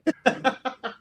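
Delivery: loud high laugh; high burst of laughter. Real, not acted. Guest cracking up at co-host's Italian accent, high laugh.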